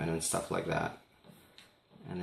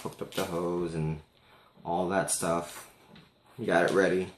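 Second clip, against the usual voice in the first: normal talking voice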